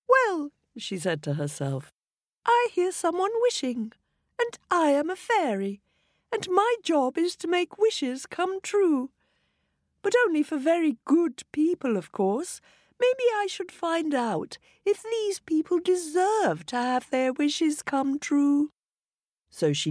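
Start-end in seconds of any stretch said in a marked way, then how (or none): 0.1-0.5 very high, light fairy voice; a high tinkling fairy musing aloud. A narrator's reading. Fairy talking to herself, high and light. 2.5-18.7 very high, light fairy voice. A narrator's reading. Fairy talking to herself, high and light.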